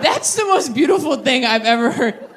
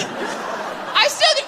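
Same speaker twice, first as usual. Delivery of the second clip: much higher and slow, breathy through laughter; high laughing redo; 14 semitones higher, 3 dB quieter, much slower than usual. Shown as a demonstration. Re-saying her odd line to the crowd, high and laughing. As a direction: squeak it out while laughing. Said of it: "I don't know why I said it like that".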